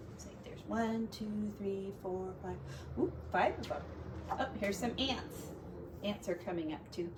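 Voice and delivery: hushed side comment; quiet aside. Real, not acted. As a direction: drop the voice for an aside.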